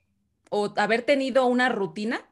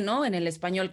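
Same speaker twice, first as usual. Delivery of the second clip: usual speaking voice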